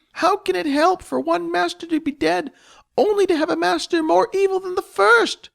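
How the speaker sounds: high squeaky goblin voice, accusing and anguished; distraught goblin. Acted. Spleef the goblin wails at Jess over the dead master.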